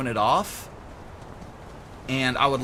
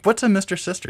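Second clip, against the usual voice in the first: high, louder confused asking; puzzled question; 9 semitones higher, 5 dB louder, faster than usual. Retold or imitated. Streamer replays asking Mike what a Mister Sister is, high and puzzled. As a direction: play it baffled, pitch up. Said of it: "I'm like".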